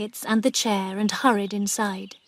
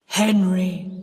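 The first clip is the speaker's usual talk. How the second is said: deep ghost voice; 2 semitones lower, 3 dB louder than usual. Acted. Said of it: "in the same deep voice".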